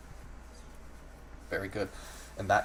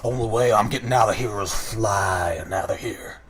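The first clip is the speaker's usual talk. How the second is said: deep chest voice of a taunting crow gang leader; deep-voiced Kenku boss; 7 dB louder than usual. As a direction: sneer at the newcomer from deep in the chest, like a tough bird boss. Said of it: "he looks at you and he says".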